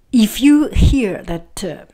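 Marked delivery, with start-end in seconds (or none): none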